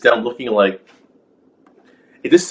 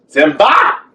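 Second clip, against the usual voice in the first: outspoken call; 6 semitones higher than usual. Retold or imitated. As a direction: call "Simba!" out like a worried father. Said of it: "how outspoken he sounds".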